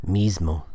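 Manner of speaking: "mismo" with a voiced Z before M; assimilated S. Shown as a demonstration. Teacher putting the word back together, "mismo" with Z for S. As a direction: say "mismo" with the S buzzing. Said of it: "pronounced with assimilation like this".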